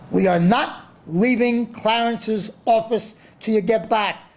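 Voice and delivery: high, slow, stubborn imitated promoter; mock Don King insisting. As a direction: lay down the ultimatum in a hammed-up promoter voice. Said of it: "he's screaming at everyone there".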